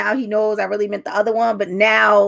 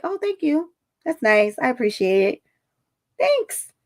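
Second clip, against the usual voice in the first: subdued polite thanks; 4 semitones higher, 3 dB quieter than usual. Retold or imitated. Subduing her happiness to husband.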